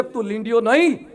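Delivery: hushed, solemn lion voice; lion's earnest reveal. Acted. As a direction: quietly tell him who he really is. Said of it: "સિંહ બોલ્યો".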